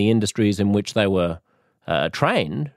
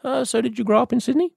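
high, quick, casual-sounding enquiry; tactful phrasing; faster than usual. Acted. Polite passenger teasing out a driver's origins, delicate. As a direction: lightly probe with a rising lilt. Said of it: "the way I would phrase it".

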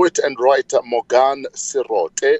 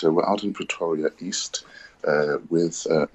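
normal talking voice

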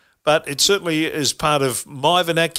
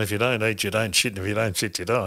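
quiet quoted line; 6 semitones lower, 5 dB quieter, faster than usual. Retold or imitated. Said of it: "she said".

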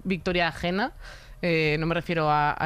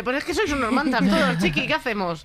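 mock taunt; 7 semitones higher, 3 dB louder than usual. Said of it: "dar la vuelta en plan de".

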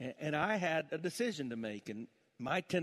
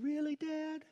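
put-on child's voice; 12 semitones higher, slower than usual. Little daughter voiced in story.

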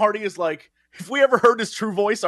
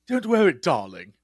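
lightly imitated suave British actor; a hint of a smooth film charmer; easy, lightly put-on "darling" voice; Tom Hardy's Inception voice; 3 semitones lower than usual. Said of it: "I like his Inception voice".

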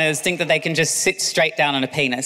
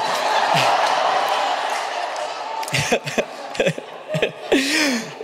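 long breathy laughter; 9 semitones higher than usual. Real, not acted. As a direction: laugh at the mistake. Laughs at misreading.

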